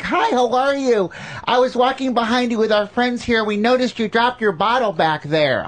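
high, sugary-sweet Arkansas tourist; chirpy tourist voice. Retold or imitated. Comic mocking a friendly tourist woman, high and sweet. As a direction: play an overly friendly, high-pitched tourist lady.

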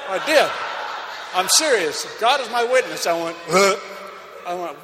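loud, high, astonished retelling; excited shout. Retold or imitated. Preacher to congregation, recounting the beach encounter, loud and amazed. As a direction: belt it out in amazed disbelief.

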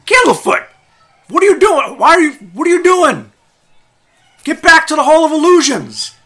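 high, loud scolding yell; shrill angry clown. Acted. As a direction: bark orders at the runaway. Clown yells at Candlefoot.

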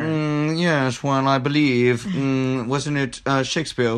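lightly pompous professor voice; a hint of a snooty scholar musing; know-it-all professor. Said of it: "the know-it-all professor".

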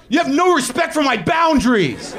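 high-pitched hammed-up outburst; mock rage scream. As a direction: explode in wounded, over-sensitive fury. Callback to his screamed rage bit.

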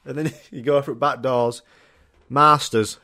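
ordinary talk, nothing marked

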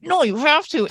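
high, emphatic retold comeback; pushy imitation of herself; mock insisting. Insisting to her reluctant husband.

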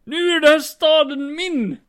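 high, slow triumphant call; grand, hammed-up conqueror's cry; villain's proclamation. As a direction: declare yourself ruler of the city. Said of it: "sen, sen säger jag".

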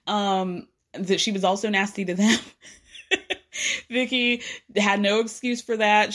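ordinary talk, nothing marked

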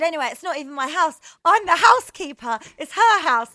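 fake housekeeper. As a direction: suddenly act the staff member. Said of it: "Pretended I was the housekeeper".

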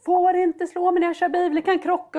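high, loud panicked yell; retold panicked yell at her son in the car. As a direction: yell at the child while driving. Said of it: "man skriker".

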